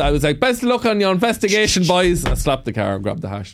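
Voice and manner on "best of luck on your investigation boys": high, hearty Cavan send-off; cheery mock-innocent Cavan accent; ramped-up Cavan accent. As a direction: call it out in thick Cavan. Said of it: "I used to ramp up the accent".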